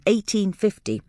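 usual speaking voice

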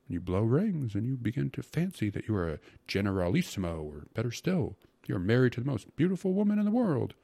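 effusive, rapturous character voice; rhapsodizing secretary. Acted. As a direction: get carried away painting the fantasy.

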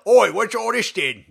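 cheeky mock Brit, punch the Oi. Co-host shows her own Bale impression, hammed-up British accent.

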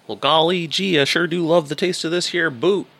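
high, folksy aw-shucks voice; gee-whiz bootlicker, sugary and naive; wholesome 1950s kid. Retold or imitated. Boot-licking parody voice.

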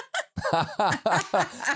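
high bright burst of laughter; amused laugh. Real, not acted. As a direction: laugh in surprise at the request. Laughs at voice request.

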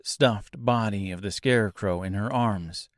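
usual speaking voice

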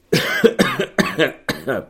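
harsh real cough; loud hacking cough; coughing fit. Real, not acted. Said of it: "j'arrête pas de tousser".

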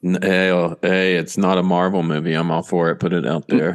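low gruff voice. Pretend. Mock endorsement.

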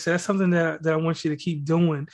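ordinary talk, nothing marked

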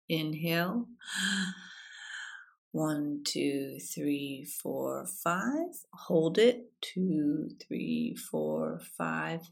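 very soft counting through held breath; guided breathing count. Shown as a demonstration. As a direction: lead a breathing exercise, hushed. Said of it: "let's do an example".

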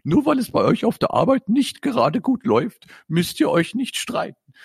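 a mother lovingly settling her sons' quarrel. Acted. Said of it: "sagte Julians Mutter liebevoll".

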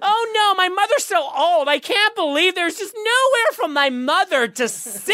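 very high, slow American lament; over-the-top falsetto American complaint; American lady. Retold or imitated. American lady fussing over her old mother's seat, exaggerated high whine. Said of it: "the lady was like".

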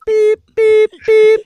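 high beeping, bleep sound; mock censor beeps over the air; censor beep imitation. Shown as a demonstration. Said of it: "kinda sounded like this".

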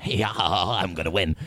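slow, smug put-on voice; hammed-up cocky Britta; mock cocky drag queen. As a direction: drawl it like you've already won. Voicing Britta's cocky entrance.

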